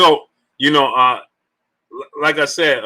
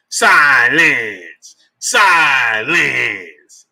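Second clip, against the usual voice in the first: loud call, stretched long and falling; drawn-out shout; 4 dB louder, much slower than usual. Pretend. Streamer calling for silence, long drawn-out shout. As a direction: bellow it slowly like a command.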